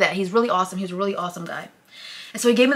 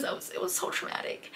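whispered, breaking with tears; near tears; 11 semitones higher, 14 dB quieter than usual. Real, not acted. Telling viewers about the trauma, near tears. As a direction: voice catching as tears come. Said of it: "I'm gonna start crying".

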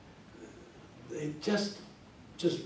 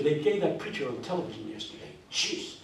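normal talking voice

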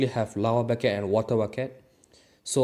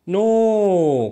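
louder, higher, slow cry of no; frustrated shout; 9 semitones higher, 11 dB louder than usual. Real, not acted. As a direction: blurt it out as the block breaks. Mistake while building.

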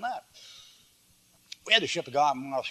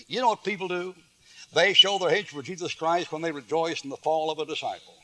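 normal talking voice

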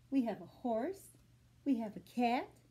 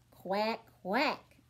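quack; 3 semitones lower than usual. Shown as a demonstration. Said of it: "the dog says".

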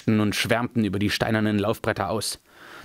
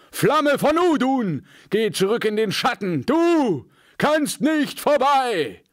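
wizard's defiance; 12 semitones higher than usual. A narrator's reading. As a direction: proclaim power against the demon. Gandalf defies the Balrog.

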